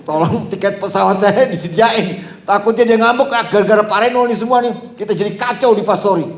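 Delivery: half-joking panicked begging; mock anxious plea. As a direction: ham up the nervous guest preacher. Mock pleading about ticket.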